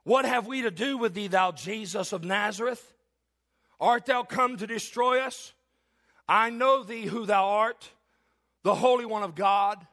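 a demon crying out to Jesus in the synagogue. Acted. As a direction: plead like a cornered demon. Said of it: "the demon begins to cry out".

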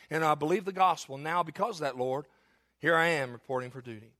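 ordinary delivery

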